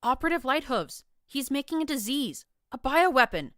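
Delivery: high, loud urgent accusation; shouting. A narrator's reading. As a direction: yell out the danger. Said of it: "Glory shouted back".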